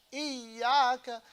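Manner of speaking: "إياك" with a long held y; doubled ya. Shown as a demonstration. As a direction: lean on the y of "إياك" and hold it. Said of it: "Shadda on the two yas".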